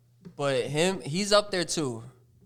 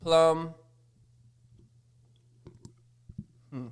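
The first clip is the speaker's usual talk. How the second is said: chewing gum. Real, not acted. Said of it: "Stop chewing your gum in the mic".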